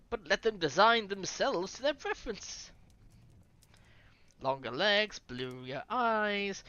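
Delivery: put-on French-accented sales pitch. Offering to redesign New England's people, French accent. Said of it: "Here's a French voice coming".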